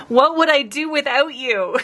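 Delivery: high squeaky laugh into sarcastic thanks; mock gratitude. Real, not acted. Streamer mock-thanking chat for worm ideas, sarcastic high laugh.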